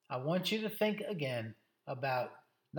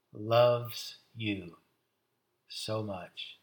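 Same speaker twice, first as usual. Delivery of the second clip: tender solemnity; 5 semitones lower, slower than usual. Real, not acted. Preacher assuring congregation they are loved, tender and solemn. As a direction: let the love sink in phrase by phrase. Solemn close on Jesus's love.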